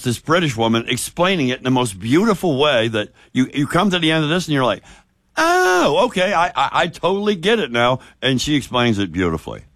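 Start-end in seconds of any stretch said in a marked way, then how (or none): 5.4-8.0 dawning realization. Retold or imitated. Listener finally getting the offside rule, dawning realization. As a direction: act out the penny finally dropping. Acted sudden understanding of explanation.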